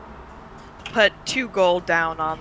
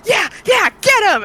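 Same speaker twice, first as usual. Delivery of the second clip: loud, high ringside cheering; cheering shout; 7 dB louder than usual. Pretend. Player cheering on the arm-wrestling orc with a loud high yell. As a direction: yell them on like a rowdy fan. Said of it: "and just start cheering him on".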